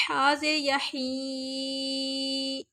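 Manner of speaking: slow, airy ہا زیر یا ہی; breathy ha. Shown as a demonstration. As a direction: push air out on ہا. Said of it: "ہا کی آواز کو بظاہر نکلے". (ہا کو سانس سے نکال کر)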